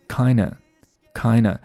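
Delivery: "kinda" with the d dropped, as kai-na; slow "kinda" said as "kina"; weakened kinda. Shown as a demonstration. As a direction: leave out the D in "kinda". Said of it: "连中间那个D都不读了".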